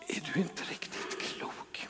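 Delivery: hushed, breathy aside of disbelief; imagined whisper. Retold or imitated. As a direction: whisper it like a scandalised onlooker. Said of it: "måste de ha tänkt".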